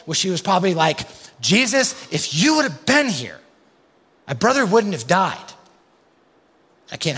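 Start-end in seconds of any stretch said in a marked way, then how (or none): 1.4-5.5 high, loud, accusing plea; demanding, frustrated reproach; confronting Jesus. Retold or imitated. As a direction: get right in his face with it. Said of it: "We wanna get in Jesus' face".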